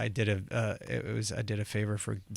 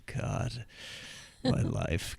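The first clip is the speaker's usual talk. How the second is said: mock despair; much slower than usual. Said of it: "in bed just going".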